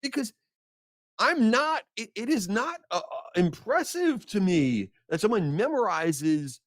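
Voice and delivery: high, outraged self-defence to friends; exasperated high. Retold or imitated. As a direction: squeak it out like an indignant rant. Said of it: "I'm like".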